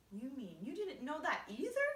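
loud, high jeering question; scornful. A narrator's reading. Centipede mocking James's ignorance, loud high scorn. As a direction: sneer loudly in mock disbelief. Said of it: "the centipede said scornfully".

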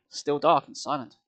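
ordinary delivery, nothing marked

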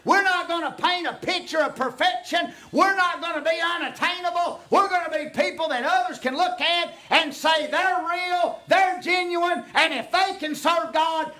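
high, loud shouting preacher; full-force preaching. Acted. Pastor rousing the congregation, shouting high and loud. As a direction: preach it out to the rafters.

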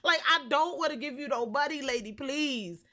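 normal talking voice

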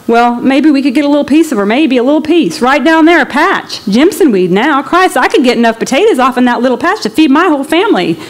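a hungry father eyeing a patch of land; eager Okie. Acted.

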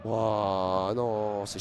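low, soft, drawn-out wow; stunned disbelief. Real, not acted. Commentator taking in the chaos, quietly stunned. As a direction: let it out under your voice, dumbfounded.

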